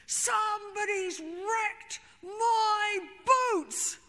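slow, high furious shout; exploding in anger. Retold or imitated. Bruce explodes into the group over his boots, furious shout. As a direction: bellow it like a man whose boots were wrecked. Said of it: "he explodes into the group".

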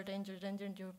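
usual speaking voice

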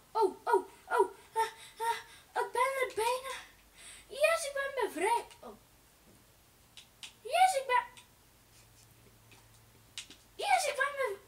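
high loud cries of triumph; triumphant. Real, not acted. Gamer breaking free, cheering loudly. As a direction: cheer like you just broke free.

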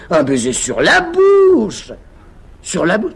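slow, loud, high insistence; emphatic. Acted. Tamise coaching Topaz, loud and slow.

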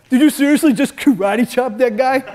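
loud, high disbelieving question; mock the coworker's shock; imitating coworker. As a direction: coworker gasping at a karate chop, loud and incredulous. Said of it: "one of my coworkers goes".

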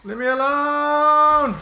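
slow, loud, high desperate yell; panicked yell. Real, not acted. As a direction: beg to be left alone. Gamer being chased.

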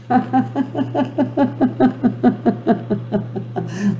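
a long, high, soft run of laughter. Shown as a demonstration. Host laughs on as the universe commands. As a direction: keep the laugh rolling. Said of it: "we better start laughing".

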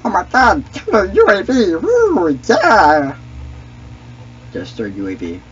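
ordinary talk

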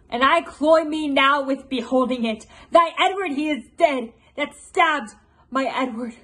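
loud, declaimed vengeful queen; Queen Margaret. Acted. Queen Margaret gloating over revenge for Edward, loud and declamatory. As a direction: declaim it loudly, hungry for revenge. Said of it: "a portion of Queen Margaret's monologue".

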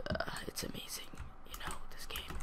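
very soft, hushed whisper; whispered aside. Real, not acted. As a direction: drop to an awed whisper. Hushed aside about the game.

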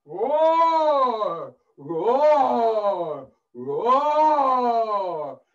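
loud, high, long-drawn roars; roaring lion. Acted. Storyteller plays the lion caught in a trap, roaring loudly. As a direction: roar like a trapped, angry lion.

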